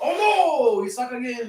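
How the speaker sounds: high-pitched, hammed-up Creole accent; mock Creole accent. Ribbing a buddy with their catchphrase, mock Creole accent. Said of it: "we say it in an accent".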